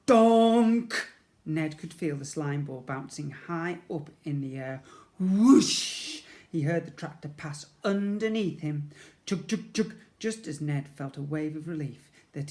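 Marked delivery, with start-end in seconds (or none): none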